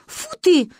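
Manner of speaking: put-on cross little girl caught out; annoyed. A narrator's reading. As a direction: huff in annoyance. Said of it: "рассердилась Маня".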